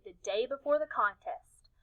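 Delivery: ordinary delivery, nothing marked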